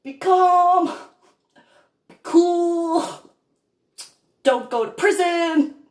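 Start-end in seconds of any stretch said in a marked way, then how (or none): 0.0-3.3 mock self-calming. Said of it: "It's, in my thoughts".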